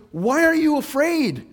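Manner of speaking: loud, high, drawn-out challenge; imitating blunt mom. Mom challenges her fearful child, blunt and loud. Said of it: "they just tell you like it is".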